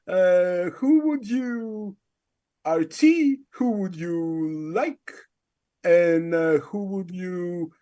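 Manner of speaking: slow, drawn-out host questions in a put-on voice; Artie impression. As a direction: imitate a halting quiz host, stretching each question. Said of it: "give us your Artie impression".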